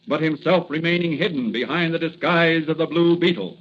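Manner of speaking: ordinary talk, nothing marked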